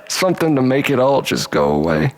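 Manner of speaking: plain reading voice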